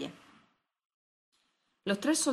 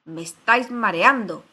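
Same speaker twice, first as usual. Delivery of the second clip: loud, exasperated royal shout; 10 dB louder than usual. A narrator's reading. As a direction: shout it at tiresome subjects. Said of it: "le gritó la reina".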